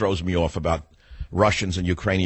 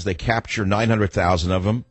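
ordinary talk

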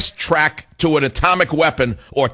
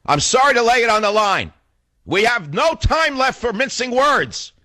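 climax of a rant, pitched way up; impassioned outburst; 11 semitones higher than usual. Real, not acted. Urgent climax of political rant.

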